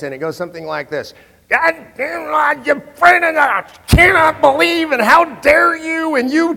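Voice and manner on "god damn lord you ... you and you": high, loud mock rant, shouting; hammed-up screaming tirade, much higher; Mark Levin rant. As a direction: rant like a furious radio host. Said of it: "what I hear is a Mark Levin rant".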